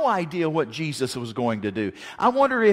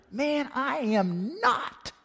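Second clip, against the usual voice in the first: higher, dragged-out protest; slow indignant denial; 9 semitones higher, much slower than usual. Acted. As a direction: stretch each word, offended. Peter denies again, acted.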